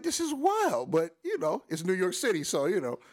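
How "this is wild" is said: high, loud burst of amazement; amazed. Retold or imitated. Spotting Paul Mooney in line, blurting out amazed. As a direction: blurt it out, stunned and thrilled.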